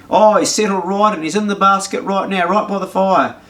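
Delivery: a hint of a hearty farmer spinning a lie; mildly hammed-up farmer fibbing; farmer's reassurance. Farmer lying on phone.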